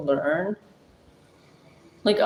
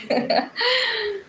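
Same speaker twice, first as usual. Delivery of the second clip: high giggle. Real, not acted. Laughs at own joke.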